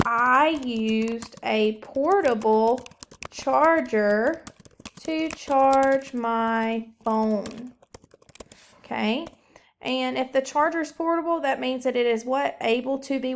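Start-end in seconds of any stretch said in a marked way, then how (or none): 0.1-7.7 each word spaced out slowly for the class; slow model sentence. Shown as a demonstration. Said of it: "[name]'s sentence is going to be".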